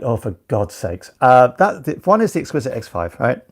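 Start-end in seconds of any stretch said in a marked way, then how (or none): 0.0-1.1 low, soft, slow sigh of annoyance; weary exasperation. Retold or imitated. The host on tiresome comment types, weary and low. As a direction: let the patience drain out. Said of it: "at this moment I'm like".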